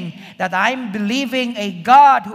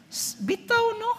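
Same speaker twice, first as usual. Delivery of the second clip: much higher, musing "Hmm, bitaw no?"; wondering Eve; 13 semitones higher, 4 dB quieter than usual. Retold or imitated. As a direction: toy with the doubt as it creeps in.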